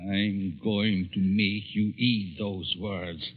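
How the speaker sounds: slow, soft, threatening; a cold vow of revenge, slowly; quiet menace. Acted. Professor threatening the editor who mocked him, quiet menace.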